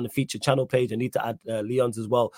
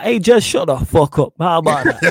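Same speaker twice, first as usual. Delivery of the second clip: mock shout; 4 semitones higher, 6 dB louder than usual. Jokingly telling co-host to shut up.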